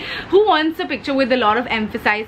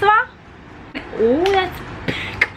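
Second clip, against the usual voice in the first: high-pitched amazed reaction; impressed surprise; 8 semitones higher, much slower than usual. Real, not acted. Vlogger reacting to her eyeshadow shade, high-pitched amazement. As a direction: marvel at it in a raised voice.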